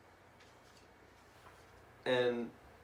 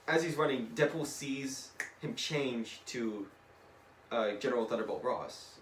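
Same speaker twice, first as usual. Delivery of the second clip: ordinary talk, nothing marked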